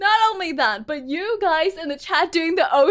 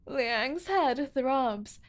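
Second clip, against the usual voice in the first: soft, lowered storytelling voice; story narration; 3 semitones lower, 11 dB quieter than usual. A narrator's reading. Narrator tells of a patient jerked awake, soft narration. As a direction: narrate it softly and deliberately.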